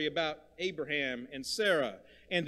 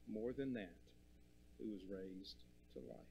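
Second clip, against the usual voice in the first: much softer, dropping almost to a murmur; hushed repeat; 5 semitones lower, 15 dB quieter than usual. Shown as a demonstration. Preacher repeating the resurrection line, softly hushed. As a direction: let it sink in quietly.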